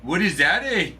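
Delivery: light Canadian twang on eh; mock Canadian question; mock Canadian accent. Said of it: "speaking a Canadian accent".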